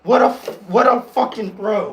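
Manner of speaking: loud, high amazed outburst; excited yell. Real, not acted. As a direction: yell it in disbelief. Reacting to game-winning throw.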